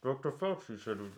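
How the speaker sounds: muffled under layered masks; through a face mask. Pretend. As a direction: speak through three masks. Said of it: "You're a triple masker?".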